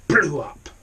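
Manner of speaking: loud burst on blew up; explosive. A narrator's reading. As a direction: make it go bang. House explodes.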